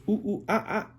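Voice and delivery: high hooting monkey calls; monkey chatter. Acted. Parent voicing Curious George, monkey chatter. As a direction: hoot and squeal like a monkey. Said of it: "and he goes".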